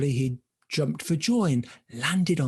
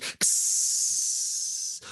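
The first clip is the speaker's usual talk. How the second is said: long sharp hiss; drawn-out eerie hissing; strange forest hiss; 3 dB quieter than usual. Acted. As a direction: hiss like something lurking in the woods. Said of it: "There were strange sounds all around".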